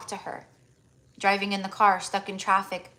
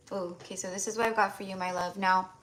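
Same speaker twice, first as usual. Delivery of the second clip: usual speaking voice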